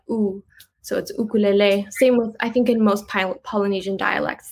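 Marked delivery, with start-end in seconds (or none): 0.1-0.4 "oo" as a pure vowel with no y before it; a plain rounded "oo"; Hawaiian U sound. Shown as a demonstration. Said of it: "So they pronounce their U's". 1.2-1.9 "ukulele" begun with oo, not yoo; "ukulele" with pure vowels, ending in lay; Hawaiian pronunciation. Shown as a demonstration. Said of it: "based off of Hawaiian pronunciation".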